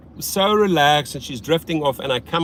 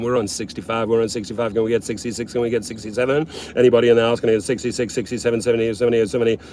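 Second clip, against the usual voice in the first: lower, monotone auctioneer patter; mock auction chant, repeating without a breath; 5 semitones lower, much faster than usual. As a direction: rattle off numbers like an auctioneer calling bids. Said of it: "It's like an auction now".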